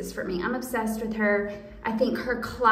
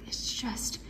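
hushed, breathy whisper of awe; 11 dB quieter than usual. Real, not acted. Whispering to viewers about the sweater's knit detail. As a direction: whisper it like a secret. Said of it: "I don't know why I'm whispering".

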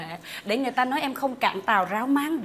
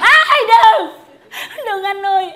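mock fright; 6 semitones higher, 7 dB louder, slower than usual. Wife fears husband's gun.

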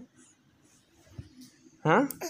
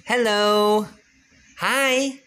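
high, bright hellos rising each time; 7 semitones higher, much slower than usual. Real, not acted. Parent greeting the baby in climbing high baby talk.

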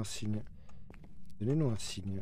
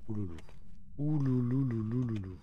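mock ghost wail. Spooky noise in dark house.

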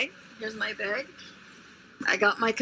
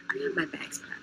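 whispering; 12 semitones higher, 6 dB quieter than usual. Real, not acted. Whispered aside to camera.

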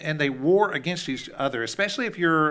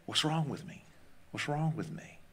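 soft, small voice of self-doubt; quiet nagging doubt; 2 semitones higher, 8 dB quieter than usual. Acted. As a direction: let the doubt echo softly. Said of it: "just screams doubts in our ears".

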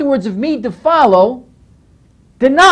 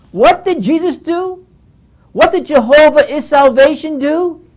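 normal talking voice

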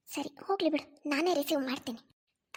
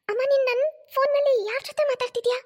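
higher voiced young daughter calling to her mother; young daughter calling out, a little louder; 7 semitones higher, 3 dB louder than usual. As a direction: call out to mom from the other room, surprised.

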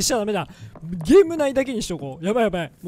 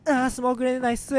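あー、スモークグレネードナイス rattled off fast like a caster; fast caster imitation; 2 semitones higher, much faster than usual. Mimicking the polished game caster, a fast スモークグレネードナイス. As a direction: rush through スモークグレネードナイス, commentator style. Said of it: "みたいになる".

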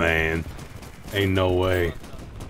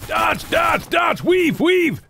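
high frantic shouting; frantic coaching yell; 12 semitones higher, much slower than usual. Real, not acted. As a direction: yell dodge and weave. Urging teammate to dodge.

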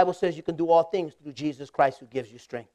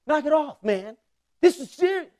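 higher, lightly voiced serious classmate; earnest recruit; 8 semitones higher, 3 dB louder than usual. Classmate telling the joker to stop, mock earnest. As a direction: scold him, half-mocking his earnestness. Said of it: "he says".

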